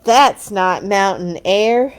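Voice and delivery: slow, loud complaint; complaining. Acted. Brother complaining to Papa about the smell, slow and loud. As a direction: grumble it out, disgusted. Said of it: "complained Brother Bear".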